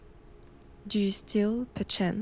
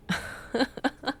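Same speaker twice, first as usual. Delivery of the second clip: airy mocking laugh; 5 semitones higher than usual. Mocking laugh after nonsense.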